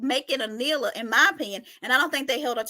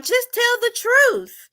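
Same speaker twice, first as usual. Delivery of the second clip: imitating Anila; 9 semitones higher, 4 dB louder, much slower than usual. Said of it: "Anila was like".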